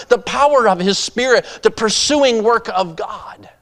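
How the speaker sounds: high, loud, driving sermon; preaching at full force. Real, not acted. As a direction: pound it home to the congregation.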